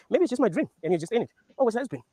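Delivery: retelling the line, excited; excited requote. Retold or imitated. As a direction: relish the line, impressed. Quoting the line again, marvelling.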